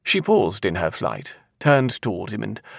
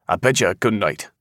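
plain reading voice

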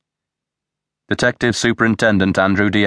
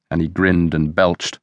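usual speaking voice

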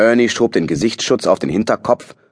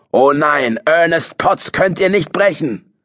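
proud defiant cry; defiant shout; 8 semitones higher, slower than usual. A narrator's reading. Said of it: "schrie er".

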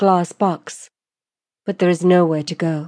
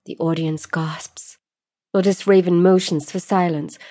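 ordinary delivery, nothing marked

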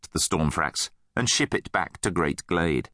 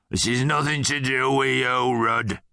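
gruff bully's growl, pitched up; growling guard; 4 semitones higher than usual. A narrator's reading. Hammerhead guard warns Rudd off, growling. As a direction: growl a warning. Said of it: "the hammerhead growled".

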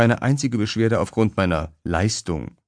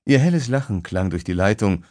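ordinary delivery, nothing marked

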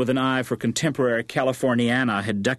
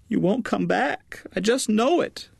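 tearful mother; 11 semitones higher than usual. A narrator's reading. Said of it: "Her mother began to cry".

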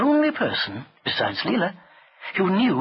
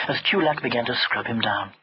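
ordinary delivery

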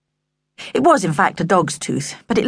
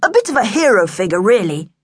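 pompous self-praise; puffed-up boasting; 3 semitones higher than usual. A narrator's reading. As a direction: puff yourself up like a hero. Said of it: "Sam puffed himself up".

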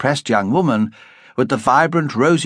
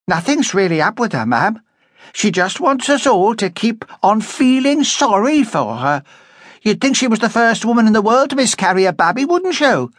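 spiteful sneer; 11 semitones higher than usual. A narrator's reading. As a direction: sneer at her grief. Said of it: "sneered spitefully".

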